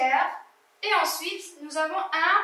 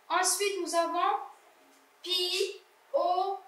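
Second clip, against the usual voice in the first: normal talking voice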